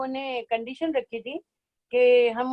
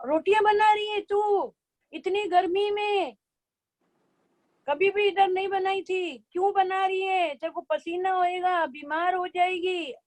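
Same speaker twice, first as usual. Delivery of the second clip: high fretting mother's voice, pitched up; fussy mother; 6 semitones higher than usual. Retold or imitated. Retold mother fretting at her daughter in the heat. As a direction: scold like a worried mom.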